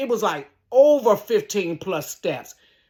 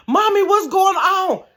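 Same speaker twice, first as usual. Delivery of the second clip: put-on kid voice; 9 semitones higher, 6 dB louder than usual. Kids at the window.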